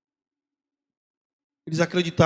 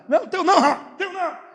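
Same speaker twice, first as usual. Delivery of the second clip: father refusing; 13 semitones higher, 5 dB louder, faster than usual. Retold or imitated. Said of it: "Aí tu".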